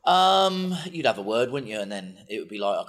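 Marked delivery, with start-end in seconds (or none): none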